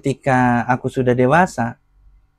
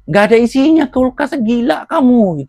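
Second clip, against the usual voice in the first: mama's scolding; 11 semitones higher, 6 dB louder than usual. Retold or imitated. Mama scolding her child over an empty fridge, high loud scolding. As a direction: imitate mama telling someone off, loud and high. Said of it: "Kalau diomelin nggak usah".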